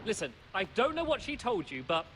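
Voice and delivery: ordinary delivery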